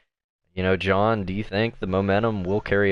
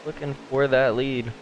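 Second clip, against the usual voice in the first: lively, raised play-by-play call; race commentary; 3 semitones higher than usual. Acted.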